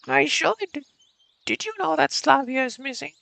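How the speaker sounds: put-on high, slow old lady voice. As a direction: play a stern old woman. Voices Olga Dmitrievna.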